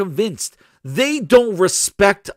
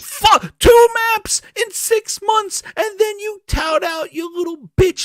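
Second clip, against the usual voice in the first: angry yell; 13 semitones higher than usual. Real, not acted. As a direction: spit each word out in fury. Repeats the news in rage.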